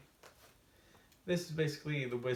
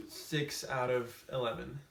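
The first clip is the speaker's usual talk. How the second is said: ordinary talk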